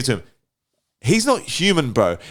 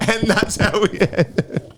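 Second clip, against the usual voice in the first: laughing; 7 semitones higher than usual. Real, not acted. Laughing, wrapping up.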